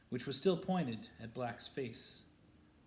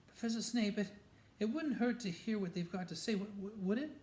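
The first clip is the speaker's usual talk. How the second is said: higher, breathless stammering plea; nervous girl stumbling over her words; 9 semitones higher, faster than usual. A narrator's reading. Hermione timidly pleading with Snape, higher and breathless. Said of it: "in a very breathless voice".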